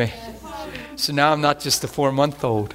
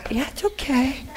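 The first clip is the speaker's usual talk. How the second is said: put-on God soothing his four-month-old, tenderly; soothing a baby; 11 semitones higher than usual. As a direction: coo it to a baby. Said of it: "patting his little four-month-old".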